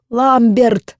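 loud high desperate scream; desperate shout. A narrator's reading. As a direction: scream the name in despair. Screams name toward burning castle.

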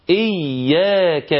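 very slow إياك, leaning long on the doubled ya. Shown as a demonstration. Teacher showing the merged letters, ya held doubled. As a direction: hold the shadda in إياك before opening to yaa. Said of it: "فتنطق".

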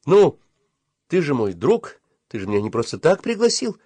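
ordinary delivery, nothing marked

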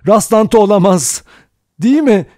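high, loud, insistent shout; loud, agitated outcry; crying out. A narrator's reading. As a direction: shout it high, demanding agreement. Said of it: "diye haykırdı Vera".

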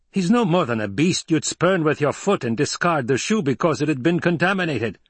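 indignant retainer's outburst; agitated loyal retainer. A narrator's reading. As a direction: plead heatedly with your lord, full of contempt. Retainer pleads with his lord.

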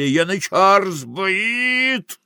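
high, slow drawn-out cry; crowd cry. Acted. Cry from the carousel about the favourite, high and drawn out. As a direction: stretch the alarm out, rising high. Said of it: "Крик в карусели".

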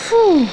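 high, loud "phew"; acted exertion puff; puff of effort. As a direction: blow out a relieved puff after heavy effort. Tom puffs at hard work.

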